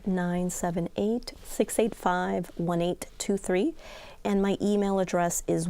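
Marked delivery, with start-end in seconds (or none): 0.1-3.7 slow, spaced-out phone number; slowly. Real, not acted. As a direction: repeat the digits slowly, group by group. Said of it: "Can you say that again slowly?".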